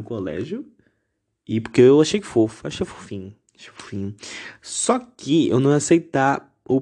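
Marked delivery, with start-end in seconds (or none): 2.7-4.1 bashful whispered aside. Real, not acted. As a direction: drop to a tiny whisper. Bashful aside admitting it was cute.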